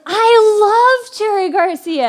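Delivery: high, loud, slow and beaming; eager and smiling. Retold or imitated. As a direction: pipe up eagerly to win her over. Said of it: "I piped up right away with a big smile".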